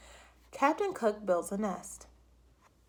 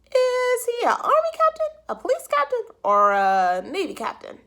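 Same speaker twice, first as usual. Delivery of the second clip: high, loud official voice; a fussy clerk on the line; phone clerk voice; 14 semitones higher, 7 dB louder than usual. A narrator's reading. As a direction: clerk quizzing Mr Popper by phone.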